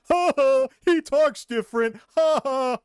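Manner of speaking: loud, high schoolyard taunt; mocking kids. Schoolkids mock the new boy's accent, loud and jeering. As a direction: jeer like a playground bully. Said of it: "They made fun of me".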